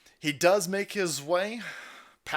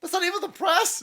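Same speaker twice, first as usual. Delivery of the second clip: a falsetto mock take of the same line; mock falsetto repeat; mock high repeat. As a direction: squeak it out way higher, mocking. Mocking repeat of own line.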